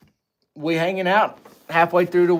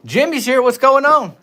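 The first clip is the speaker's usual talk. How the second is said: ordinary delivery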